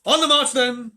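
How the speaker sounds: high and loud marching order; rallying call. A narrator's reading. Peter leads the children off at night, loud rallying call. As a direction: call the troop to move out.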